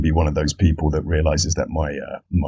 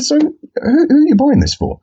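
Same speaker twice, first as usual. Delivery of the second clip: innocent query. Retold or imitated. Innocent question to friend.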